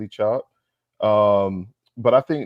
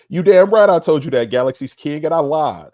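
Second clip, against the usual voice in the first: loud; 6 semitones higher, 7 dB louder than usual. Real, not acted. Answering a chat comment defiantly.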